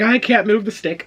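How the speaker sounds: high-pitched helpless whine, retold; mock helpless. Retelling his plea to watching officers, high mock helplessness. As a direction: plead helplessly, hammed up.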